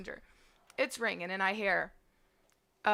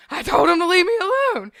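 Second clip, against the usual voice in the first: higher and louder, shouting it at the family; indignant shout; 9 semitones higher, 12 dB louder, faster than usual. Retold or imitated. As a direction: shout it at the kids staring at you. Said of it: "And I'm like".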